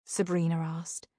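ordinary delivery, nothing marked

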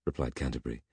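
plain reading voice

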